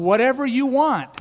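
high, bright-eyed willing yes; eager agreement. Acted. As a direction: gush your willingness.

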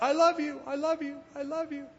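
high echo that fades with each repeat; mountains echoing the boy, fading; echo shouted back. Retold or imitated. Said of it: "the mountains shouted back".

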